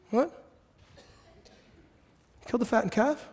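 soft, high rising question; stunned disbelief. Acted. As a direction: echo the news in hushed disbelief. Stunned brother repeats servant's news.

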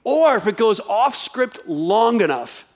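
ordinary talk

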